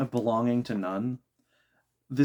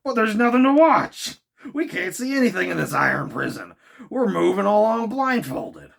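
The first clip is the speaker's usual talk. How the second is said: higher, loud frustrated protest; indignant outburst; 10 semitones higher, 7 dB louder than usual. Acted. Ned protests their iron prison, loud and indignant. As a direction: rage at being kept blind. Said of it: "exclaimed the harpooner".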